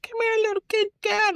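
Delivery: very high sing-song coax; high cooing voice for a kitten; coaxing a kitty. Retold or imitated. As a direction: coo it up high to lure the cat. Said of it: "I'm like".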